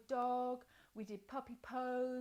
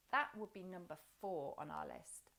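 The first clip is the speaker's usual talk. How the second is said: usual speaking voice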